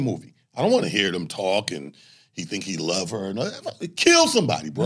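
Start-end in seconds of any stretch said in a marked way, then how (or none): 4.0-4.9 excited demand. Retold or imitated. Moviegoer wanting action.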